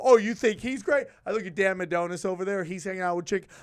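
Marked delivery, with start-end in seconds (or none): 0.0-1.1 louder mocking jab; sarcastic challenge. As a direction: needle her, loud and teasing. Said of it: "I go".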